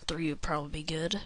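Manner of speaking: ordinary delivery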